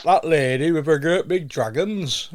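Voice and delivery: broad Northern English send-up; Northern English accent. Acted. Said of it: "you might lose your accent".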